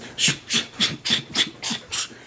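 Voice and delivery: repeated voiceless shushing bursts; imitated rustling noise. Woman walks past with her pockets full, imitated rustle. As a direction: mimic hidden loot rustling with hissed "shh" sounds. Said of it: "all I hear is".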